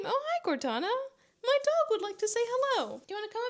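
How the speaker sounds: high-pitched cooing to a dog; greeting a pet. Real, not acted. Greeting and coaxing her dog.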